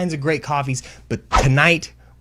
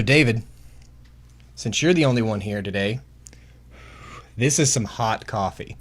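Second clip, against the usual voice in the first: ordinary talk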